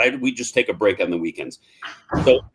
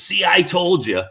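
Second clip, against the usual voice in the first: told-you-so; 9 semitones higher, 5 dB louder than usual. Retold or imitated. Friend gloating on phone.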